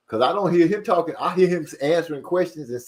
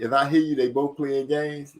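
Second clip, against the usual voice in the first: ordinary talk, nothing marked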